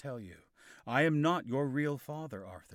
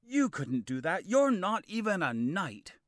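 higher, loud angry retort; 7 semitones higher, 7 dB louder than usual. Acted. Kay angrily dismissing Arthur's claim, loud and scornful. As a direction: scoff at Arthur with anger. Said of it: "looked around angrily".

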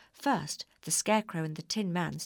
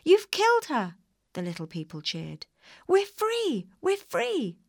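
very high, loud cheering little people; 10 semitones higher, 7 dB louder than usual. A narrator's reading. Little people cheering that the witch is dead. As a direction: shout for joy.

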